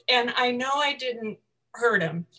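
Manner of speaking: ordinary talk, nothing marked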